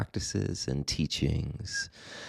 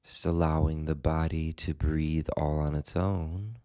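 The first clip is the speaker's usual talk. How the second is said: guided meditation; 3 dB quieter than usual. Acted. Meditation teacher guiding listeners into the body and breath, calm guided meditation. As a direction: guide them gently, leaving space after each phrase.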